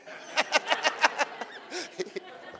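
high breathless cackle at his own joke; high airy laugh. Real, not acted. Preacher laughs at his own gloating story, high airy laugh.

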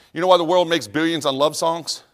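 ordinary delivery

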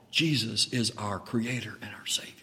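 hushed reverent whisper; whispered awe. Real, not acted. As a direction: breathe it in awe.

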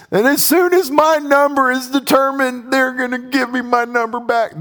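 high, mocking voice of a petty victim; mock aggrieved voice. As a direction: play the wronged man counting his due. Voicing a wronged person's scheme.

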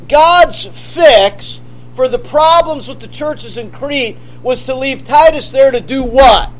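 usual speaking voice

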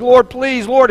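acted-out begging; begging prayer. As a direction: plead urgently with God. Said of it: "and he begged".

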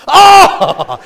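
high, loud yelp; a sudden cry when kicked; yelp of pain. Retold or imitated. Missionary kicked under the table, loud yelp. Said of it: "West Africa goes".

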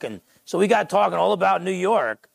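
ordinary delivery

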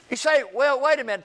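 ordinary talk, nothing marked

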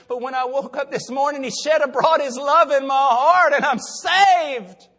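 high, loud, building to a drawn-out cry; jubilant testimony. Retold or imitated. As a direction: belt out the retold good news. Voices woman's joy at being saved.

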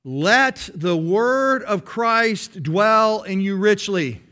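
very slow, every word weighted; slow deliberate recitation; slow emphatic verse. Shown as a demonstration. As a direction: drive the verse home slowly. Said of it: "Memorize that verse".

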